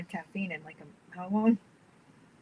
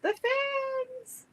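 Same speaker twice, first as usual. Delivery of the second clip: high doll voice. Pretend. Voicing the doll.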